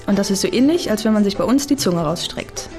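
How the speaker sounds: usual speaking voice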